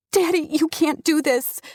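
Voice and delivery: begging. Acted. Daughter begging father, pleading. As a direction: beg him desperately. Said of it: "she begged".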